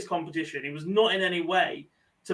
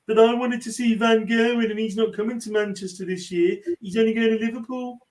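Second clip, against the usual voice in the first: put-on moaning fan in a Brummie accent; a Brummie whinge, up high; 5 semitones higher than usual. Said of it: "In a Brummie accent".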